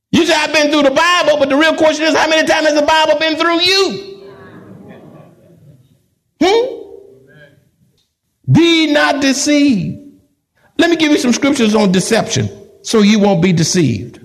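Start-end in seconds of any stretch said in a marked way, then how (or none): 0.1-6.6 preaching. Acted. Preaching at full force. 8.5-10.0 slow solemn warning. Acted.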